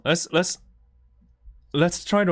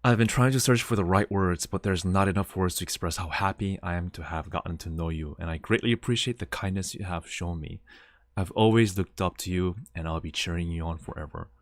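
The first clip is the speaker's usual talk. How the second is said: reading a heartfelt message; 5 semitones lower, 5 dB quieter than usual. Retold or imitated. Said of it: "reading the farewell message aloud".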